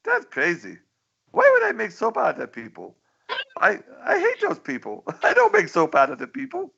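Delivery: high, fast mock dictator, laughing through it; high, giggly cartoon villain voice; cartoon Pol Pot voice. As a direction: squeak out the outrage like a cartoon tyrant. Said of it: "And Pol Pot said".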